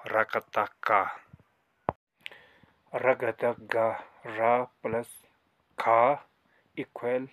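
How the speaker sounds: रगता गा read out slowly, syllable by syllable; slow demo reading. Shown as a demonstration. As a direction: sound out each letter for the learners. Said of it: "इसको मैं फिर से पढ़ता हूँ". (धीमे-धीमे अक्षर जोड़कर पढ़ना)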